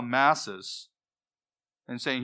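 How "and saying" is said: ordinary delivery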